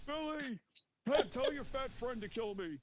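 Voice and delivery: put-on high old man voice; South Park grandpa. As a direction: beg like a cartoon grandpa. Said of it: "the grandpa voice from South Park".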